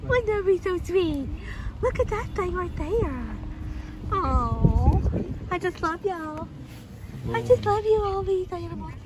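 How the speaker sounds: high, cooing delight; high-pitched sweet talk; gushing affection. Real, not acted. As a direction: gush over adorable animals. Gushing over the animals.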